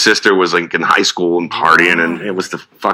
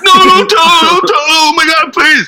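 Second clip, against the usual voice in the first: put-on high, loud panicked child plea; panicked pleading; 4 dB louder than usual. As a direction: beg like a scared kid. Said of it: "And I was like".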